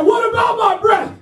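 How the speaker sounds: very high, strained challenge; scary sergeant. Retold or imitated. Sergeant confronting trainee over his breath, intimidating mock outrage. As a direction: play an affronted sergeant scaring a recruit. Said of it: "And he scared me".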